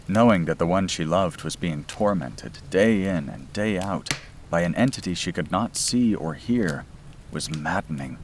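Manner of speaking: usual speaking voice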